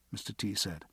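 plain reading voice

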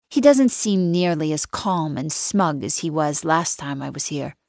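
normal talking voice

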